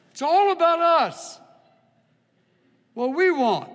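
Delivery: high, loud, smug proclamation; mocking selfish boast. Shown as a demonstration. Preacher to congregation, mocking the selfish creed in a loud, high crow. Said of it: "Don't look at me like that".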